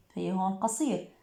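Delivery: normal talking voice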